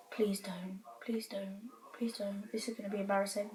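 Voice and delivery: hushed, desperate whisper, the last line rushed; pleading whisper. Retold or imitated. Granddaughter begging her nana under her breath, a pleading whisper. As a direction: beg under your breath not to be seen.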